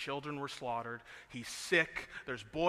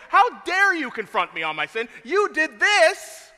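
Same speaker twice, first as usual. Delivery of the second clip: loud, higher, accusing shout; indignant family member; 10 semitones higher, 15 dB louder than usual. Retold or imitated. A family member confronted on sin, yelling back in outrage.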